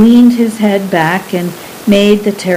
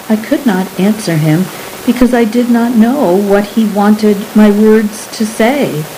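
usual speaking voice

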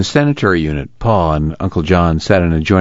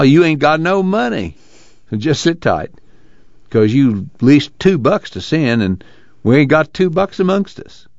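higher-pitched, folksy reproof; dismissive Pa; 8 semitones higher than usual. Acted. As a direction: talk him out of it, pitch swinging up.

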